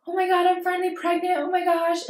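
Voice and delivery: high, fast, bubbly burst of joy; excited news. Retold or imitated. Calling her sister with happy pregnancy news, high and excited. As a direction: squeal it out like you just saw the positive test. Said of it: "I've called her with, like".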